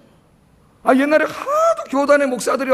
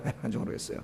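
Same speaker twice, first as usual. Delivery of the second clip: breathy whisper, repeating the complaint; whispered aside; 18 dB quieter, faster than usual. Shown as a demonstration. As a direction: drop to a whisper for the echo. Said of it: "나는 왜 나가는지 모르겠어요". (속삭이듯 되뇌는 말)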